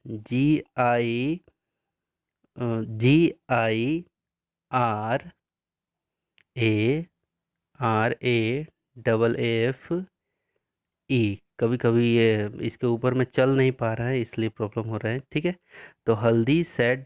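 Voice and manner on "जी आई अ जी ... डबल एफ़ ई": slow, spaced-out letters for copying; slow dictation spelling. Shown as a demonstration. Teacher dictating spelling slowly to pupils. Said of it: "लिखेंगे आप".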